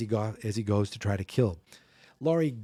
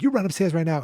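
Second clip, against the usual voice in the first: higher, louder mock command; imitated urgent order; 6 semitones higher, 5 dB louder, faster than usual. Heroine ordering the boy upstairs, imitated urgent raised voice. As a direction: send the kid off urgently, acted lightly.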